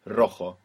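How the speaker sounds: "Rojo" starting on a rolled R; rolling R. Shown as a demonstration. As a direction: roll the first R of "Rojo", J as "kh". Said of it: "it will be the rolling R".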